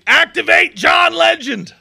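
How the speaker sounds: high, slow, shouted command; hammed-up shouted order, stretching John; mock battle cry. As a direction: bellow it like summoning a hero. Mock rallying call, shouted.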